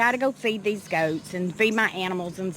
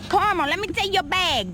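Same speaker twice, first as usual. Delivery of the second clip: higher sing-song call to the nanny goat; gentle high summons; coaxing call; 8 semitones higher than usual. Real, not acted. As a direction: coax the goat over. Calling the mother goat.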